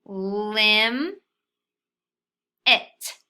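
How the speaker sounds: Lim- and it said apart with pauses; split into syllables. Shown as a demonstration. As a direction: read limit syllable by syllable, Lim- then it.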